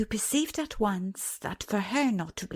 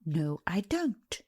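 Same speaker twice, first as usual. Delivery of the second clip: offended tone. A narrator's reading. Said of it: "in an offended tone".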